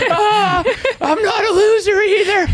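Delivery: high, loud comic wail; hammed-up tearful blubbering; mock sobbing. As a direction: wail it as if sobbing, laughing underneath. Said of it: "I'm just sobbing on a six A M flight".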